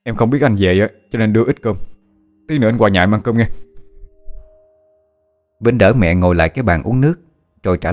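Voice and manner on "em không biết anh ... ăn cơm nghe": glad surprise, talking fast; quick, happy chatter at seeing him; delighted exclamation. A narrator's reading. Cousin glad to see Vĩnh home, speaking fast. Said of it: "Tùng mừng rỡ kêu lên".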